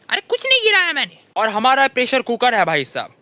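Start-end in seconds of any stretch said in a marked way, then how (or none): 0.1-1.2 much higher, quick flustered denial; defensive denial. Pretend. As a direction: brush it off like you were caught. Caught dropping a pot, denies. (बर्तन गिरने पर घबराकर, ऊँची आवाज़ में इनकार)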